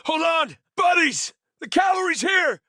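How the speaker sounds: high, loud rallying shout. A narrator's reading. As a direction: call out to the rescue. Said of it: "he shouts".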